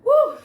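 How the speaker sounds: loud high whoop to release emotion; emotional whoop. Real, not acted. Overcome while telling her story, a loud emotional whoop. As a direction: let out a high cry of relief. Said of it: "I'm getting emotional right now".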